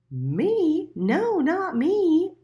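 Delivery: high, loud and slow denial; an innocent bird shrugging it off; pigeon voice. A narrator's reading. The pigeon denying it to Little Mole, high and slow.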